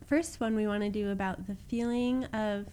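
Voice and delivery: ordinary talk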